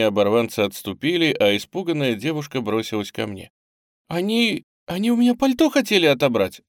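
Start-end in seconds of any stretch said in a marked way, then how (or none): 4.1-6.6 high, fast, loud frightened outburst; breathless cry. A narrator's reading. Girl running to her rescuer, breathless cry. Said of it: "задыхаясь, вскрикнула она".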